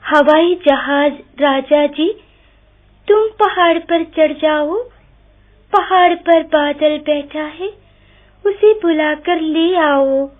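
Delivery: pleading goat. A narrator's reading. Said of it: "इस बार बकरी बोली".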